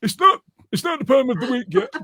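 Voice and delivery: very high, loud mock scolding; Jeanette impression. Host mimicking co-host objecting before poem of the week, hammed-up impression. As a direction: put on an exaggerated high voice and odd accent, imitating her. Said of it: "I don't know what that accent is".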